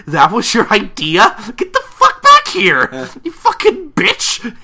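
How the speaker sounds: high, loud screech breaking into laughter; hammed-up fury. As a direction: yell in mock outrage, stretching the swearing. Acted outburst at fleeing friend.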